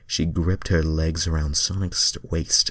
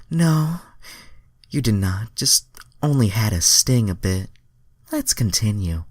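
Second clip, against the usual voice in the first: high, louder falsetto reply; Starfire voice; 5 semitones higher, 3 dB louder than usual. Acted. Starfire reassuring Sonic, high falsetto. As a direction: pop up high to answer. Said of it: "Starfire answered".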